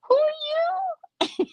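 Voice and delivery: very high, slow and drawn out, like looking down her nose; mock disdain. To the stranger texting her, a slow, very high mock-haughty question. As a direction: stretch it out up high with exaggerated disbelief. Repeating her reply to Caesar.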